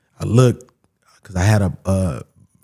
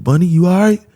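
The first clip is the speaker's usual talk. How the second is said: high, louder retold check-in; imitated officer's raised, rising call; concerned call-out; 9 semitones higher, 5 dB louder than usual. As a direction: call out a worried question, rising sharply. Officer calling out to him.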